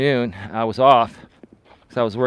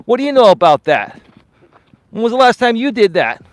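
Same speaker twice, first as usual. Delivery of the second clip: high, loud indignant challenge; retold angry thought; 10 semitones higher, 8 dB louder than usual. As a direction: fire questions back at dad, re-enacted. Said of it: "I was pissed".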